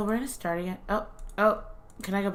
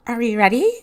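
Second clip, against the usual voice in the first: high, loud bubbly streamer voice; gamer girl voice; 6 semitones higher, 12 dB louder than usual. Pretend. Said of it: "gamer girl voice".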